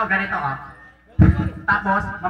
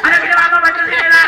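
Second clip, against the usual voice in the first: loud, high-pitched pretend scolding; mock outrage; 6 semitones higher, 8 dB louder than usual. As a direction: scold in exaggerated disbelief at the breakage. Mock scolding over broken fan. (kunwaring galit na pasigaw)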